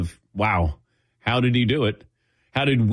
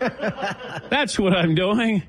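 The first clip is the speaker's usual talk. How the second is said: high-pitched, half-laughing retort; mock exasperation; 8 semitones higher, slower than usual. Fainting host answers the nurse, mock exasperated. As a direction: pitch it up in comic protest.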